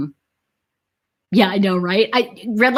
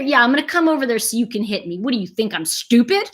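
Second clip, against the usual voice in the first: normal talking voice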